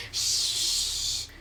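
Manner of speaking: long hushing "shh"; urgent shush. Acted. Hushing Siri so parents sleep, long shush. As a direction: shush them with a long breath.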